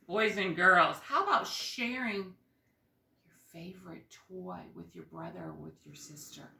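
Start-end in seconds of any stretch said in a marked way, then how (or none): 3.3-6.5 whisper. Real, not acted. Teacher drops to a whisper.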